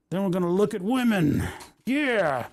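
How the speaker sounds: put-on playful good-ol'-boy voice. Continues trucker bit.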